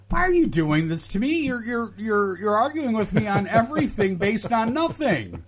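high-pitched, worn-out complaint; exasperated plea. Real, not acted. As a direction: plead with a co-host who keeps arguing. Exasperated at co-host arguing with everything.

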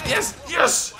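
high, loud cheer; squealed celebration; triumphant shout. Real, not acted. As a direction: shout it in elated victory. Wins a chase in game.

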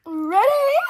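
high, loud, rising "Ready?"; loud ready call. Pretend. Getting set to sing, repeats it louder.

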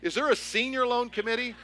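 high, loud incredulous question; alarmed disbelief. Retold or imitated. Pastor retelling his alarm at the junior committee, high and incredulous. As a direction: squeak it out in mock panic.

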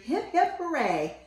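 high, bright hip hip hooray; cheering. A narrator's reading. Moms and dads cheering the hero home, high cheer. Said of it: "Remember how we cheered".

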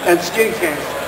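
choked up with long pauses; fighting back tears; intermittent tremor. Real, not acted. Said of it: "I just get emotional".

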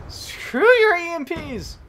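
drawn-out angry scream; angry yell. Real, not acted. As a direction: rage at the EMPs. Raging at game.

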